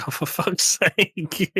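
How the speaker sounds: laughing exasperated groan, slow and high; a soldier fed up as his leader bagpipes in, laughing. Retold or imitated. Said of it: "You'd be like".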